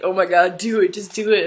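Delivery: loud, high, hyped-up friend; imitating an excited friend cheering someone on; excited urging. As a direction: egg them on at full volume. Friend urging her on, retold.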